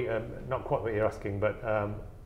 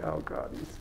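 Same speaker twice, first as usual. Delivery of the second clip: whispered inner thought; 5 semitones lower, 6 dB quieter, faster than usual. Retold or imitated. Inner thought, aghast at smuggling.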